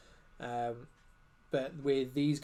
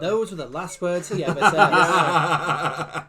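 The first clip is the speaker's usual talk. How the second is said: laughing; 10 dB louder, much slower than usual. Pretend. Joking after a friend's jab.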